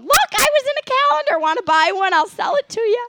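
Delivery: put-on high, loud, quick old-lady voice boasting about her calendar; old woman showing off her calendar photo to strangers; eighty-year-old voice. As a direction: crank up a chirpy elderly brag. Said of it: "Was that your eighty-year-old voice?".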